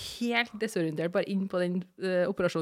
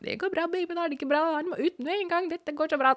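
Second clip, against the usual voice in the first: mock hurried midwife; 9 semitones higher, faster than usual. Panicked staff voiced.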